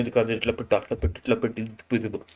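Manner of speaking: low, soft aside giving directions; feed instructions under your voice, low and quiet; imitating a helper. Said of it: "Suman శెట్టికి help చేస్తున్నారు". (సాయం చేస్తున్నట్టు మెల్లగా, తగ్గు గొంతుతో)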